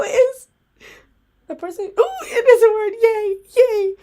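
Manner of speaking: normal talking voice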